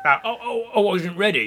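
ordinary talk

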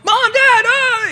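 very high, loud panicked shriek; panicked scream. Retold or imitated. Kid finds the house empty, fears the rapture, panicked shriek. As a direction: scream it out as if everyone vanished. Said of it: "he's freaking out going".

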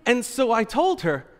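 normal talking voice

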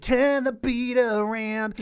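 imitating over-the-top Australian singing. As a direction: belt it in a wild Australian accent. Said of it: "over the top, like, Australian accent".

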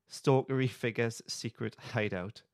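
ordinary talk, nothing marked

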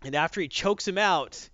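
ordinary talk, nothing marked

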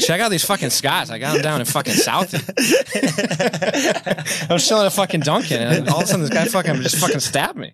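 put-on Boston accent, breaking into laughs; Boston Joker laughing. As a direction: crack up while doing a Boston Joker. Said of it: "if the Joker was from Boston".

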